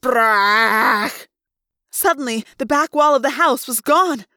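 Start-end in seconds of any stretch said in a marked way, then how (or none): 0.0-1.3 loud raging elephant trumpet; elephant trumpet in rage. Acted. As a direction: trumpet like a terrified, furious elephant. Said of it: "I trumpeted in terror and rage".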